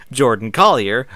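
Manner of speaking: normal talking voice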